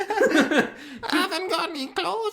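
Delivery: high falsetto through suppressed laughter; hammed-up high character voice; Tina. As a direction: play a silly woman on the phone. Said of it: "It's Tina".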